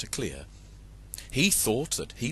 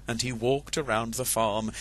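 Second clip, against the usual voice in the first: ordinary delivery, nothing marked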